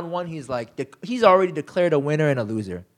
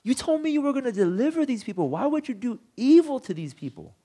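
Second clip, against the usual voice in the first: high, pleading accusation, rushing toward the end; high accusing cry; 8 semitones higher than usual. Retold or imitated. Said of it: "But he accuses God".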